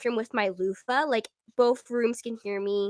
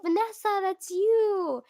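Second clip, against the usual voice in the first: high, loud mock squeal of recognition; high-pitched fan spotting someone; imitated calling out; 9 semitones higher, 5 dB louder, slower than usual. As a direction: call out high to someone far below. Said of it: "you guys would be like".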